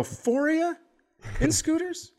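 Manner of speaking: high, mock-incredulous squeak; a sarcastic high-pitched jab at the family; mock incredulous question. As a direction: ask it in a high, mocking falsetto. Retold mocking question.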